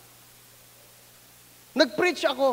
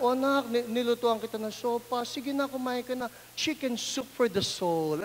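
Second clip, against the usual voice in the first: soft coaxing mother; 3 semitones lower, 7 dB quieter than usual. Retold or imitated.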